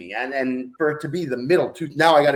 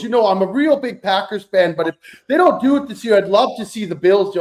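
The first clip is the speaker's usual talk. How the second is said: higher, louder chatty mom voice; imitating mom; 7 semitones higher, 5 dB louder than usual. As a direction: voice your mom gushing at a guest. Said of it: "My mom goes".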